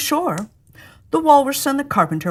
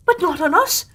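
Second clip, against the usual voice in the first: high little cry of alarm; frightened oysters; 9 semitones higher, 3 dB louder than usual. Acted. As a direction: squeal in protest at being eaten. Oysters realise they're dinner.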